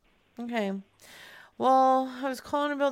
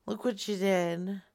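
ordinary delivery

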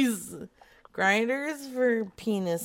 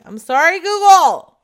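much louder and higher; shouted apology; 7 semitones higher, 14 dB louder than usual. Pretend. Apologising to Google on her phone, shouted. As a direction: holler it out to the phone.

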